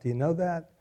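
ordinary delivery

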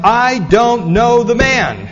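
loud, high, slow furious denial; swearing denial. Acted. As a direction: hammer out the oath like a cornered man. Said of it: "he swore to them".

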